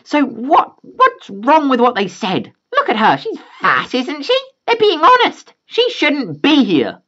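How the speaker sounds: very high, slow sneering mother; entitled mom. Acted. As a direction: squeal it out like a snotty mom. Entitled mom mocks girl.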